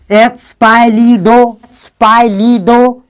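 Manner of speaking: एक्स पाई ली डो in slow, separate beats; slow model sounding out of एक्स पाई ली डो; syllable-by-syllable. Shown as a demonstration. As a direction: hold each syllable of एक्स पाई ली डो evenly. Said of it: "इस word को syllable में तोड़ेंगे".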